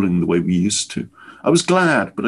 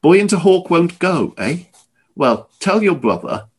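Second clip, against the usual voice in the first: mock-angry old man, higher; sudden anger; 8 semitones higher than usual. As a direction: snap out the threat, half in earnest. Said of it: "His voice was suddenly angry".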